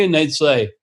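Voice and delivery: usual speaking voice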